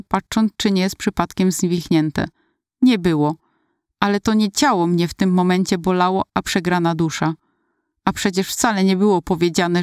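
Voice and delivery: ordinary delivery, nothing marked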